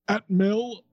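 put-on high old northern English man; northern English accent. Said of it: "one of my many great accents".

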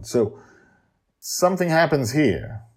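usual speaking voice

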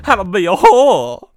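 loud, high parody of a sitcom grandma; mock sitcom grandma. Podcast host mimicking the clip he just played, hammed-up sitcom grandma.

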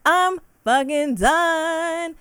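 high, drawn-out "done"; exasperated sign-off. Real, not acted. Ending her rant about y'all, exasperated drawl. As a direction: stretch it out high, totally over it.

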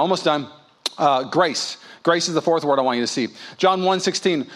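ordinary talk

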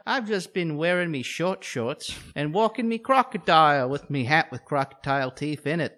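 jokey put-on Aussie con man; mock Australian. Co-host mocking a charming Aussie scammer, mock Australian accent. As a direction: ham up an Aussie charmer asking for cash. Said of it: "I'd just be like".

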